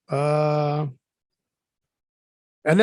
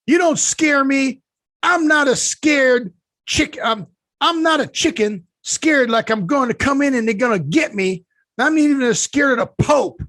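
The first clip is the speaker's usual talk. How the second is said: high, loud, slow defiant yelling; imitating the mom's baffling angry boast; defiant rant; 8 semitones higher, 3 dB louder than usual. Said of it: "This is the one I didn't understand".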